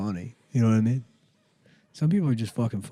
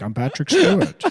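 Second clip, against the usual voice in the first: loud, higher, accentless fake impression; bad Patrick Stewart; 4 semitones higher, 6 dB louder than usual. Comic mocking a friend's hopeless impression, loud and high. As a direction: announce it loudly with no British accent at all. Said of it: "He's not even doing a British accent".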